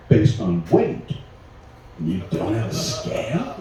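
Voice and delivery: slow, low build to a rising question; mock disbelief. Passenger quizzing security about the scale, incredulous. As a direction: question them with pointed, drawn-out incredulity.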